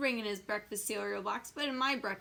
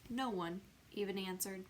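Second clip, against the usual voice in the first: usual speaking voice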